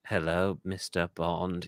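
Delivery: low, soft, slow menace; menacing British villain. Acted. Host mimicking Donald Pleasance's Bond villain, soft menacing voice. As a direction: greet him like a sinister British mastermind. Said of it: "He's, like, very British, very menacing".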